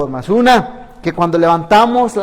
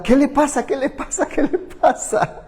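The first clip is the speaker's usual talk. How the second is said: higher, startled and laughing through it; alarmed asking; 8 semitones higher than usual. Retold or imitated. Church sister startled by a call for help, higher with laughter. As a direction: ask what's wrong, alarmed, half laughing. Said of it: "se asustó tanto".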